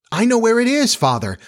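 put-on high, eager child calling out; eager cry. A narrator's reading. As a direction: pipe up, keen to help. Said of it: "Wendy cried".

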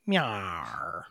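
low drawn-out cat meow. Shown as a demonstration. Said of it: "I should use, like".

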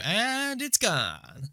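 long drawn-out high mimicry; South Park meme. Quoting the South Park banker meme, drawn-out high imitation. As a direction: stretch it out high and slow, imitating the cartoon. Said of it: "that South Park meme where".

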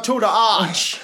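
high, put-on Missouri twang; teasing a friend with a hammed-up Saint Louis voice; mock Saint Louis accent. Friend teasing Vinny about Saint Louis, mock Missouri accent. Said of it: "Do they sound like this now?".

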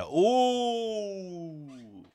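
long high falling "oooh"; sliding gossipy "ooh"; scandalized "ooh". Real, not acted. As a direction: react to a savage burn, drawn out. Mock reaction to a burn.

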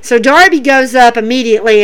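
normal talking voice

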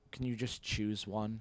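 lightly voiced weary parent; a hint of a fed-up dad; exasperated parent. Imagined parents' reply.

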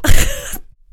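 loud, high laugh; burst of laughter. Real, not acted. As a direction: crack up at the joke. Cracks up at temple joke.

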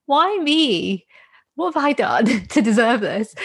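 self-pitying. Retold or imitated. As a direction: play up poor-me despair. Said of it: "feeling sorry for myself".

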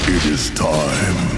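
slow booming voice from above. Acted. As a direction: boom it out slowly like a god. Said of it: "you hear a booming voice".

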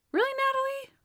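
high, incredulous mock scolding; exasperated high-pitched question, put on; mock disbelief. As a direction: challenge an absent character in disbelief. Scolding a TV character.

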